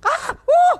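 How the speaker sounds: very high, loud yelps; two sharp high screams; startled shriek. Real, not acted. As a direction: yelp out loud in alarm. Startled during the game.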